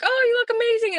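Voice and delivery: very high-pitched imitated gush; gushing friend. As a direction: put on a high, sing-out friend voice for a compliment. Voices gushing friend in example.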